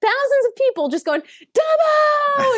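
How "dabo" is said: loud, high game-show yell; Dabo yell. Retold or imitated. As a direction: belt it out like a croupier calling the win. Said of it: "yell like that".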